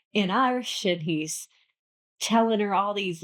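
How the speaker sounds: ordinary delivery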